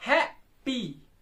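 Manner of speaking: Happy in two beats, the first one strong; strong-weak stress. Shown as a demonstration. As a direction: let पी drop in happy. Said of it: "नीचे आ रहा है पी".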